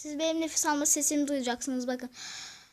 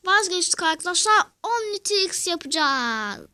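loud announcement; 3 semitones higher, 6 dB louder than usual. Real, not acted.

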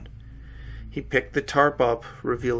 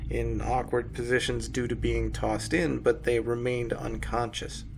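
usual speaking voice

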